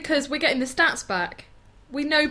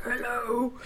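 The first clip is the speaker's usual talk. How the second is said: softer, crackly croak imitation; crackly old woman; 5 dB quieter than usual. Shown as a demonstration. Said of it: "like, crackly".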